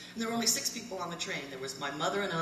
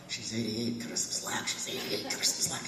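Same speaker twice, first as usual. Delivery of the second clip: telling herself; 7 semitones lower than usual. Retold or imitated. I had to say to myself.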